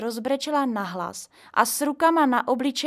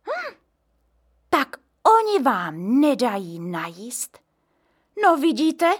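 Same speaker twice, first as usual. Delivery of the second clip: gossipy outrage; 4 semitones higher, 6 dB louder than usual. A narrator's reading. Neighbour seizes on gossip.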